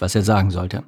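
ordinary delivery

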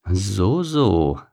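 understated condescending baboon; a touch of smug, knowing superiority; superior amusement. A narrator's reading. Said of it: "lächelte überlegen".

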